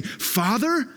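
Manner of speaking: high, rising disbelief; shocked echo with rising tune; incredulous question. Retold or imitated. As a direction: question the word as if scandalized. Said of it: "the disciples and people just flipped out".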